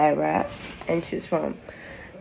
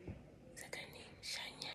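real whisper, much softer; 31 dB quieter, slower than usual. Real, not acted. Vlogger asking the camera about a new friend, whispered. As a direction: breathe it so she can't hear.

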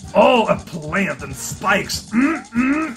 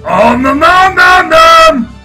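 loud eating noises; 8 semitones higher, 10 dB louder, slower than usual. Acted.

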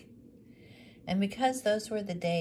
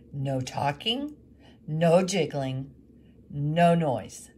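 louder, slow, stern commands; ordering sternly; 9 dB louder, slower than usual. Acted. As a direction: lay down the rules. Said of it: "he ordered Thomas".

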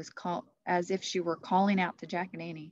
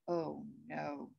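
much softer dismayed murmur; inner thought; 2 semitones lower, 9 dB quieter than usual. Acted. Jack dreading the quest, quiet inner thought. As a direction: think it to yourself. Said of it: "thought Jack".

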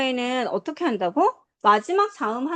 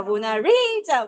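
"모나리자"의 "리"를 길고 세게; 느리게, "모나리자"의 "리"에 강세; slower than usual. Shown as a demonstration. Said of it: "이게 강세잖아". ("모나리자"의 "리"를 세게 끌어서)

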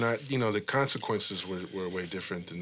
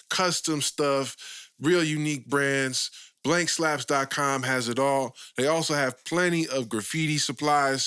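upbeat sponsor read; lively host ad spot; 4 semitones higher, 4 dB louder than usual. Acted. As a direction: sell it like a podcast ad.

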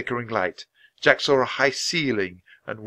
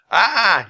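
loud, high-pitched scream; frightened yell; 10 semitones higher, 7 dB louder than usual. A narrator's reading. Jack seeing the floating bone, frightened yell. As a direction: scream in alarm. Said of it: "yelled Jack".

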